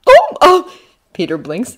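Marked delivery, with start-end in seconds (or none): 0.1-0.6 high, hesitant surprise; startled boy's filler; surprised. A narrator's reading. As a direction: stammer it high, caught off guard.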